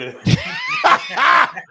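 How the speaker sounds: slow laughing refusal. Real, not acted. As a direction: laugh through the words.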